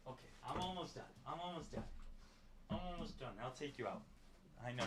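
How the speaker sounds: calm the eager dog down; soothing the dog. Real, not acted. Streamer promising his dog a walk, softly reassuring.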